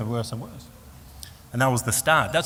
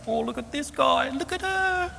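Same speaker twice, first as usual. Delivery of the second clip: very high, mocking squeal of a voice; exaggerated high-pitched ridicule; making fun; slower than usual. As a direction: poke fun at worshippers, pitched way up. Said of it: "making fun of it like crazy".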